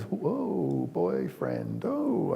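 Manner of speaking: put-on swooping pitch, big slide down on boyfriend; a dad caught off guard; mock surprised dad. Dad reacts to daughter's boyfriend.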